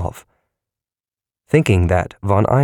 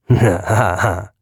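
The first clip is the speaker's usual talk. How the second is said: gloating. A narrator's reading. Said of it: "it gloated".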